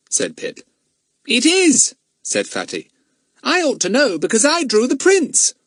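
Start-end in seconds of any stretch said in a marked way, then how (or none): 1.3-5.5 very high, loud certainty; boastful young expert; emphatic schoolboy. Acted. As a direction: insist in a high, cocksure child's voice. Fatty insists confidently.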